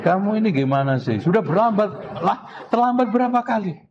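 high, sharp scolding rising to a peak; scolding teacher. Shown as a demonstration. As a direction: berate the late student, climbing in pitch. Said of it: "Itu beda lagi". (guru memarahi murid yang terlambat dengan nada tinggi)

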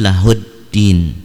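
LAHUDDIN with doubled d and silent l; slow "lahud-din", holding the double d; assimilated lam. Shown as a demonstration. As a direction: go straight from "lahu" into "ddin". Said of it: "Alif lam yang tidak dibaca".

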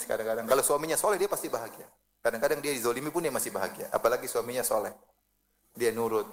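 ordinary talk

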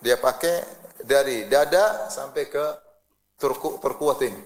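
normal talking voice

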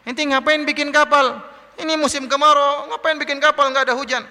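high-pitched jeering taunt; mocking. One of Noah's people ridicules his shipbuilding, mocking. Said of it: "Ada lagi ngejek".

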